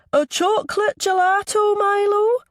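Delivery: small boy mimicking a Scottish accent, very high and loud; high-pitched child's Scottish impression. A narrator's reading. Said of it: "in an impressive Scottish accent".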